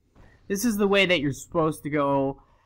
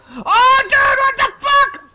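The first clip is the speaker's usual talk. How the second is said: very high, loud, drawn-out disbelief; piercing gamer freak-out; shocked scream; 11 dB louder, slower than usual. Real, not acted. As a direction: shriek it slowly in real alarm. Startled by game attack.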